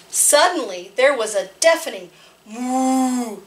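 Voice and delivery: put-on lively read-aloud voice for small children; storytime narration. Librarian reading a farm picture book to children, lively.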